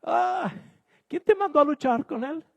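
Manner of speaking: high, sing-song mock scolding. Someone mocking a listener who fought the pig, high mock scold. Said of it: "Usted va a salir y dice".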